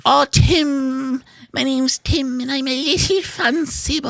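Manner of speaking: put-on high, prissy fancy-boy voice; squeaky high mocking dandy; Tim impression. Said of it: "Here's my impression of Tim".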